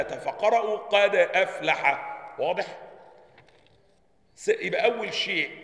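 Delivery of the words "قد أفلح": "قد أفلح" slowly, still d then a clear glottal stop; hamza fully pronounced. Shown as a demonstration. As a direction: keep the d of "قد" vowelless and attack the hamza of "أفلح". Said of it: "سكنوا الدال وحققوا الهمزة".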